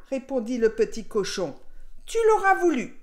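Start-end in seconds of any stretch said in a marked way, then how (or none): 2.1-3.0 loud, high wolf's shout; angry storybook wolf yell; howled threat. A narrator's reading. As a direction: bellow the warning, pitch raised. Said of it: "hurla le loup".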